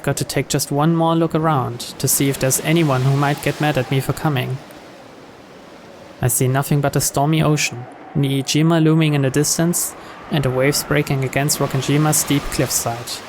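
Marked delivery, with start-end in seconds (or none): none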